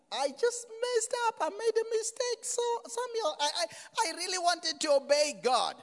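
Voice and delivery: very high, loud wailing imitation; hammed-up sobbing plea in a squeaky-high voice; mock crying. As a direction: imitate a penitent king wailing on his knees. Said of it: "on his knees crying".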